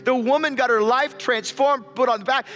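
high, loud, slowed climactic preaching; rising preaching climax. Real, not acted. Preacher climaxing the story to the congregation, soaring and loud. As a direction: build it to a soaring peak.

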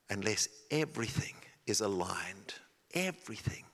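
soft breathy insistence on everything; whispered emphasis on everything. Acted. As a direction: hush it to make it land. Preacher whispers key point.

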